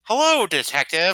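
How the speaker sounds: high, nerdy lecturing tone; prim, lecturing killer voice. Retold or imitated. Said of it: "like, I like the".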